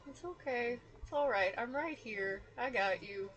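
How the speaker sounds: soft, tender comfort; gently calm her down; soothing. Acted. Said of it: "pet through her hair".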